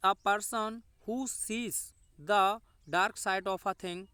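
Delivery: drawn-out, word-by-word reading; slow dictation. Shown as a demonstration. Teacher reads the definition to students, very slow dictation. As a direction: spell it out slowly so the class can write.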